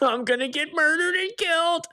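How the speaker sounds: high-pitched comic squeal of terror; mock panic. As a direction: squeal it like a joke victim. Fear of drunk crowds, exaggerated.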